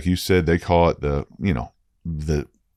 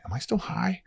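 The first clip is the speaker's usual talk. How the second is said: much higher, hushed wondering voice; mock wonder; 8 dB quieter than usual. Watching the waves with the group, hushed high wonder. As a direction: ask it high and breathy in disbelief.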